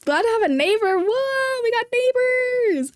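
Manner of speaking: high, drawn-out happy whoop; excited cheer. Real, not acted. Streamer greeting a nearby viewer in chat, excited cheer. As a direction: stretch it out high, celebrating.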